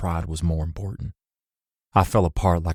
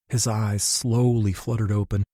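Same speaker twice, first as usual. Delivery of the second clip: ordinary delivery, nothing marked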